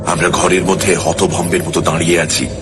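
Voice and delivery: ordinary delivery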